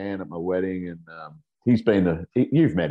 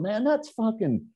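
angry neighbour; 14 semitones higher, slower than usual. Retold or imitated. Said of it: "one guy's going".

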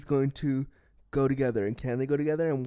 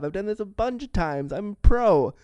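fake bravado; 6 semitones higher, 4 dB louder than usual. Lying about kissing experience.

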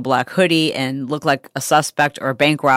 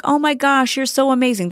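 mock gushing; 9 semitones higher than usual. Mocking praise seekers on social media.

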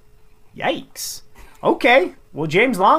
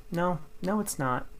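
mock parents, much softer; 11 dB quieter than usual. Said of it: "Mom, Dad, it's real".

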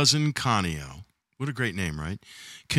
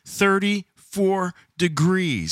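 extremely slow and deliberate, slightly raised pitch; slow emphatic repeat; 5 semitones higher, much slower than usual. Real, not acted. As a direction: stretch every word for effect. Repeats figure for effect.

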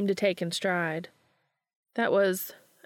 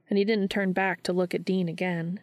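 plain reading voice

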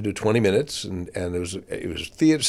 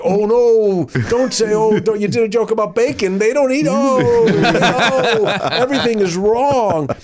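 much higher and louder, in a panic; panicked inner voice; 10 semitones higher, 4 dB louder, slower than usual. Retold or imitated. Comic panicking in his head while bombing, high and loud. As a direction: spiral into panic mid-set. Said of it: "And like".

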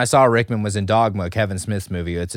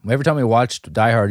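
usual speaking voice

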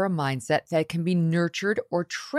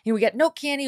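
higher, whiny kid voice imitating her son; son's complaint; 8 semitones higher than usual.

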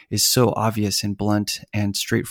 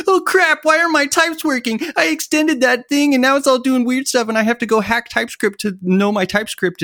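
much higher, strained voice of a developer in a panic; panicked developer; 12 semitones higher than usual. Retold or imitated. Developers secretly panicking over TypeScript, in a much higher, panicked squeal. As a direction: squeal it like someone whose code just broke.

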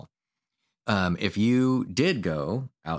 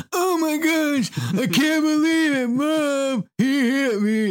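very high, slow squealing tattletale to mom; whiny kid brother; 6 dB louder, slower than usual. Retold or imitated. Brother poked in the car, squealing to mom. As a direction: ham up a little brother whining to mom. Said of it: "And he goes".

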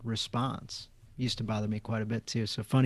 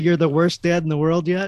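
put-on whiny child; 6 semitones higher, 11 dB louder than usual. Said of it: "child's complaint quoted".

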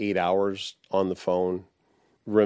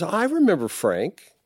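put-on high fond voice of the old girlfriend; 9 semitones higher than usual. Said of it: "And she goes".

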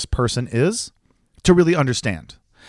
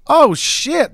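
high, louder burst of surprise; mock startled; 13 semitones higher, 5 dB louder than usual. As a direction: imitate a neighbor startled by bright light. Neighbor startled by the bright light.

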